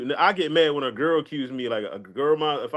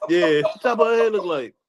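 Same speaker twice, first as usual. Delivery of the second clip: laughing; 3 semitones higher, 4 dB louder than usual. Real, not acted. Repeating his own joke, laughing.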